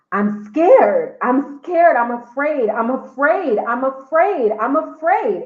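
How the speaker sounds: terrified, pleading through tears; frightened sobbing. Retold or imitated. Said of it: "while crying and shaking uncontrollably".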